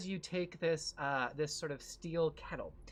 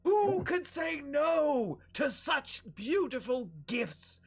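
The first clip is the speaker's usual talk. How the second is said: booming villain shout; 9 semitones higher, 6 dB louder than usual. Acted. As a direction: shout out the summons like a grand villain. Triclops offers drink to heroes.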